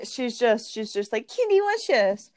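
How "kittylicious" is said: high squealed pet name; gushing aunt impression. Aunt calling her cat over wine, high gushing squeal.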